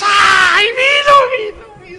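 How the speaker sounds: a mocked crowd going wild over water turned to wine; ecstatic cry. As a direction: shriek with amazement as if seeing a miracle. Crowd amazed at the miracle.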